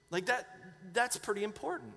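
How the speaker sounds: ordinary delivery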